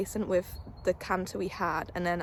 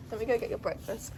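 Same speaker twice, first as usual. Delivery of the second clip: normal talking voice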